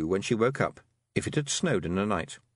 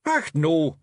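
raised gruff little Scots pixie, pleased with himself; boastful wee Feegle, a bit louder; Rob Anybody's Scots voice; 5 semitones higher, 5 dB louder than usual. A narrator's reading. Rob Anybody boasting to Tiffany after the fight, Scots pixie voice. Rob's voice.

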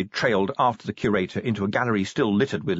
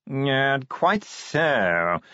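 posh yawning drawl; 3 semitones higher, much slower than usual. Acted. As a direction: drawl every word like a yawn. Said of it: "modulated yawning".

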